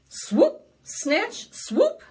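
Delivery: loud high swoop, snatch, swoop; pouncing tiger. A narrator's reading. Tiger pounce sound words.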